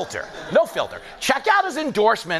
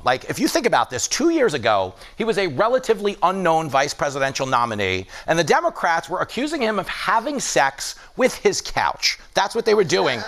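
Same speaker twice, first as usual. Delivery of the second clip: usual speaking voice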